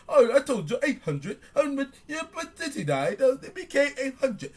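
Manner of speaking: high mocking British voice; lightly done mock British accent. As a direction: mimic the UK narrator's accent, mocking. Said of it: "he come with that fool-ass accent".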